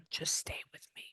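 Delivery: very soft whispered aside; hushed request for patience; concentrating whisper. Real, not acted. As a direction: whisper it while focused elsewhere. Concentrating, asks client to wait.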